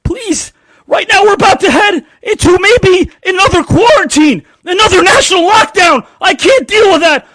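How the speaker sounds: high, loud pleading whine; pleading with the boss. Retold or imitated. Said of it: "tap him on the shoulder and say".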